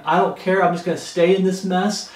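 normal talking voice

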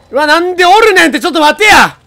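high, loud panicked shout; frantic shocked yell; startled yell. Real, not acted. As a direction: cry out when ambushed. Ambushed by enemy.

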